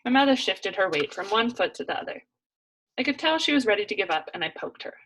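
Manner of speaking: ordinary delivery, nothing marked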